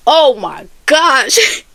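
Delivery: slow, tearful cry of awe; overwhelmed by the memory; overcome crying out. Real, not acted. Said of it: "overwhelmed recalling the miracle".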